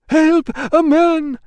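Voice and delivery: very high, loud level scream; panicked bather screaming; scream for help. Acted. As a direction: keep shrieking for help.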